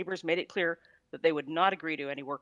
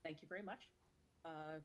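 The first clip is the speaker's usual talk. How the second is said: distant, faint voice off mic; speaking from across the room; far from mic; 20 dB quieter than usual. Real, not acted. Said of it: "You sound like you're far away from the mic".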